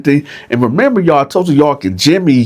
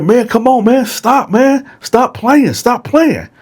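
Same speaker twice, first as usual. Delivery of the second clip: much higher, urgent begging; Dylan begging Bone to stop, getting more desperate; desperate pleading; 11 semitones higher than usual. Retold or imitated. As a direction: plead for him to stop playing.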